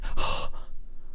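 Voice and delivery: exaggerated breathy gasp; mock-horrified gasp. Commentator ridiculing mothers' complaints, fake horrified gasp. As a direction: gasp in feigned shock.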